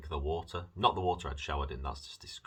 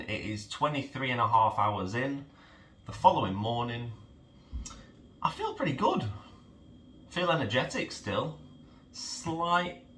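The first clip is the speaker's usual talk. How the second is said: higher, louder upbeat update; upbeat morning; 9 semitones higher, 7 dB louder than usual. Real, not acted. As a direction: keep it bright and energetic.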